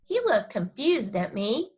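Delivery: ordinary delivery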